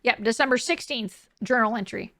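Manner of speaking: usual speaking voice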